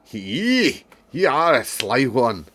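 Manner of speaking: put-on accent slipped into Scottish, loud and high; high, loud Scottish lilt. Reader voicing a maid's line, accent strays into Scottish. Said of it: "She's gone Scottish".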